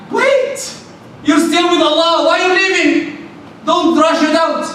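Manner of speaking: wait, high and pressing; urgent call. Real, not acted. Preacher stops worshippers rushing off, urgent high call.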